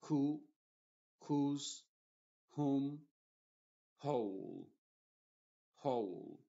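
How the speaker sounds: who, whose, whom start on an H breath with no W; WH words said with H. Shown as a demonstration. Teacher models silent W: who, whom, whole. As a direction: say who as hoo and whole as hole. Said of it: "la H es la que sí se pronuncia".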